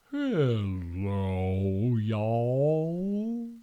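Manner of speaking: low, slow southern drawl "Hello, y'all"; southern accent. Pretend. Shark greeting listeners in a mock Southern accent. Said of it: "like, a southern accent".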